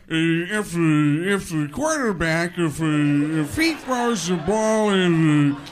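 loud, slow, stammering football commentator; drawn-out, monotone, hesitant commentary impression; John Madden. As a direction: bumble and repeat like a stuck sportscaster. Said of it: "Madden will say things like".

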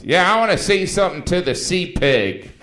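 loud, high mocking heckle; heckler from the audience. Rude audience member jeering at Ricky, loud and mocking. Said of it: "mean people in the audience would offer up the advice".

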